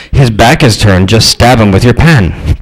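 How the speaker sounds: ordinary talk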